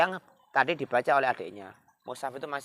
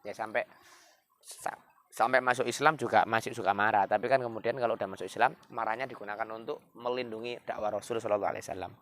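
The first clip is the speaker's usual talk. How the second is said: ordinary talk, nothing marked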